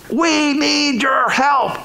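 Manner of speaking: high, long-drawn distant holler; calling for help. Acted. Overloaded fishermen hailing partners on shore, calling out.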